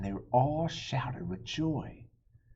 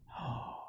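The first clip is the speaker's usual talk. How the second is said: gasp; 9 dB quieter than usual. Acted. Crowd expects a fight.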